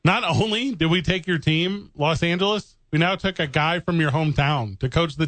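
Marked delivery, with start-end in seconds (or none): none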